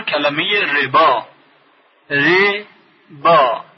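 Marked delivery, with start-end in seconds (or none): 2.1-3.6 ربا stretched very slowly, و silent; ربا drawn out, read "reba"; slow demo. Shown as a demonstration. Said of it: "حرف واو ناخواناست".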